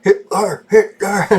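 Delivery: high-pitched joking chant; mock cheer. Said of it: "cheer up the mood by cheering".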